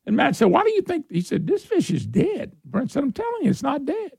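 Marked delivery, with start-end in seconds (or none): 0.5-1.1 mock incredulous. Retold fishing argument, Matt. 1.5-2.5 insisting. Retold or imitated. Retold fishing argument, Matt. 3.0-4.1 stubborn insisting. Retold or imitated. Retold fishing argument, Brent.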